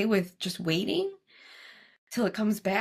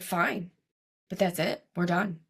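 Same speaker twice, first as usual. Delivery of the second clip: usual speaking voice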